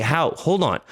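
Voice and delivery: ordinary talk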